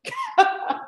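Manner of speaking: short high-pitched laugh. Real, not acted. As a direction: let out a quick high cackle. Laughs at own line.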